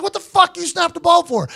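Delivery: high, furious demand; angry yell. Retold or imitated. Punter yelling at the holder over the snap.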